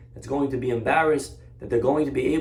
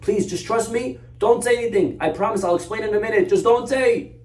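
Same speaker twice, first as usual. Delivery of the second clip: urgent pleading; 8 semitones higher, 3 dB louder than usual. Retold or imitated. Barren lady urgently pleads silence.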